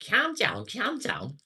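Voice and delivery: very high, exaggerated Scouse squawk; mock Scouse. Showing a viewer how Scousers sound, hammed-up high Scouse. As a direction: send up a Liverpool accent in a high voice. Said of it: "It's the way they say it".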